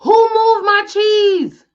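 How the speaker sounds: high, slow, drawn-out holler. Retold or imitated. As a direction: holler it to the sky. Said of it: "Then he hollered".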